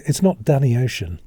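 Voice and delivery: normal talking voice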